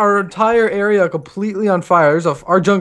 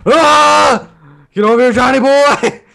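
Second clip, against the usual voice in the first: excited yell; 6 semitones higher, 4 dB louder, slower than usual. Real, not acted. Charging enemy.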